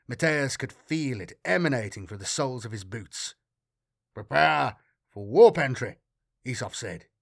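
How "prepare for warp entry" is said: louder, tight, urgent command; tense. A narrator's reading. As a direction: bark the order through tension. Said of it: "his voice tight with tension".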